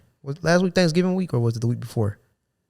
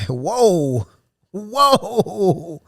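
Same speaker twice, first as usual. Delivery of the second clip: mock surprised; 7 semitones higher than usual. Reacting to being threatened.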